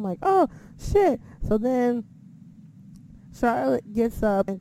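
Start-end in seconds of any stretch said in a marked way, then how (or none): 0.2-1.2 high, startled exclamation; retold shock. Reacting to the cash-in announcement, retold shock. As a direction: let it jump up high in surprise.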